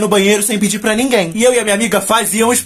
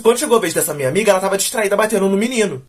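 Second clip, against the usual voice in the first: normal talking voice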